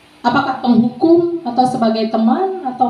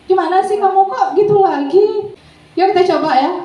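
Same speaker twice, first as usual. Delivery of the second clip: friend; 7 semitones higher than usual. Shown as a demonstration. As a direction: lightly play a pal grumbling in singsong. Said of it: "layaknya teman".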